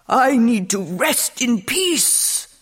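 slow, drawn-out weary complaint; moaning mummy king. A narrator's reading. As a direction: moan slowly like a mummy disturbed in its tomb. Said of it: "moaned the king".

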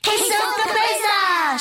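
very high layered chorus shout; group shout. A narrator's reading. Chimichangos call from back of class, shouting. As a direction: shout it high, all together. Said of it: "shouted the chimichangos from the rear".

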